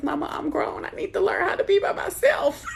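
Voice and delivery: high, loud laughing protest; laughing shout. Retold or imitated. To her mom, insisting she's grown, a high laughing shout. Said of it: "I was like".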